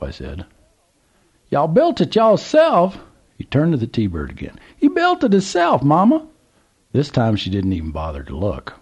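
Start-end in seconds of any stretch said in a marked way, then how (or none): 1.5-6.2 put-on old Southern gentleman. A narrator's reading. Old man calls to trucker, high Southern voice. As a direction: play the curious old man at the gas pump.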